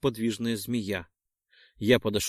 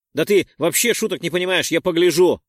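louder, higher mocking comeback; loud retort; 5 semitones higher, 7 dB louder than usual. Perk retorts to Roldon, loud mocking comeback. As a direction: fire back the jab loudly. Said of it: "парировал азиат".

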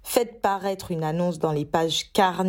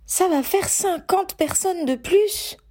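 hesitant; 11 semitones higher than usual. Acted. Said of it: "hésita Ginny".

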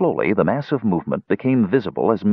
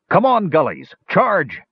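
calling a charge; 9 semitones higher than usual. A narrator's reading. Flint calling troops to charge.

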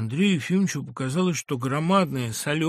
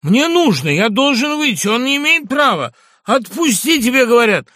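much higher, loud angry shouting; furious demands; 11 semitones higher, 11 dB louder than usual. Acted. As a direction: demand release, enraged. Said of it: "крикнул Иван Дмитриевич".

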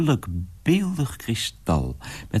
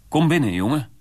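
calling out; 8 semitones higher, 3 dB louder, faster than usual. Acted. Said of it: "riep hij".

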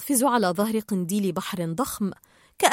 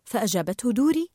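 ordinary delivery

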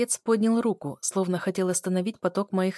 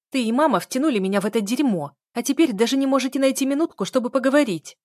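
higher, louder heated accusation; open anger; 6 semitones higher, 6 dB louder than usual. A narrator's reading. Daughter blaming parents for no time to talk, angry. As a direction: hurl the blame, pitch and volume up. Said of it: "не сдерживая гнева".

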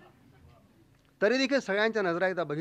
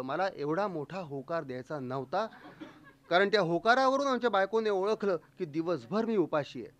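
ordinary talk, nothing marked

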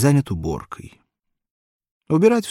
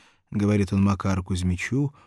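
plain reading voice

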